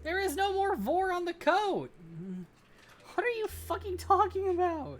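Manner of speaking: high, very loud outraged shout; exasperated yelling. Real, not acted. Streamer ranting at someone over the coat, exasperated yell. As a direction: yell it in sheer disbelief.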